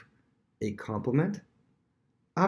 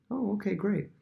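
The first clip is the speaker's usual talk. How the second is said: wary thanks; 10 semitones higher than usual. Retold or imitated. Wary reply to flattery.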